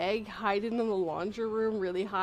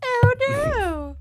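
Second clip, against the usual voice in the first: high, loud cartoon dismay; mock alarm; 13 semitones higher, 8 dB louder than usual. Acting out coffee spilled everywhere, mock panic. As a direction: wail it with hammed-up panic.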